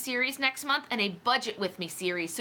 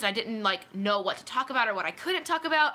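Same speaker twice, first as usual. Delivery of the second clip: usual speaking voice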